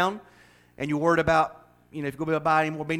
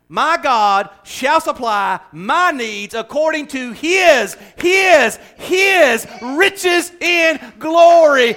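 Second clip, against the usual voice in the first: much higher, louder, slow preaching climax; full-force gospel preaching, higher and louder; 12 semitones higher, 12 dB louder, much slower than usual. Real, not acted. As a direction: lift the verse up, slow and soaring.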